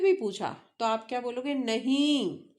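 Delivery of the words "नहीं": "नहीं" stretched out with an angry push; emphatic, long "नहीं" for show; demonstrated angry refusal. Shown as a demonstration. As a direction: draw out "नहीं" as a mock angry no. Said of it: "वो anger show कर रहा है".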